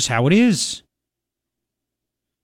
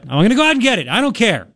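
raised, insistent retold reply to his wife; insistent raised repeat; 6 semitones higher than usual.

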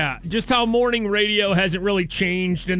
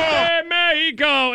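high drawn-out goal call; long goal yell; 9 semitones higher, slower than usual. Retold or imitated. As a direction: stretch out the goal cry like a commentator. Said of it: "it's this sound right here".

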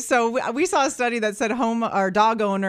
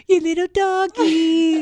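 doggy voice; 5 semitones higher, slower than usual. Shown as a demonstration. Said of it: "talk to your little doggy".

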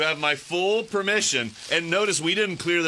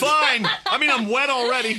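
laughing; 6 semitones higher, 3 dB louder than usual. Real, not acted. Soaked, laughing.

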